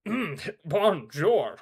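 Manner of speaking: slow, attempted French greeting; half-hearted French accent. Player playing a French king, poor French accent. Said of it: "I can't do a French accent".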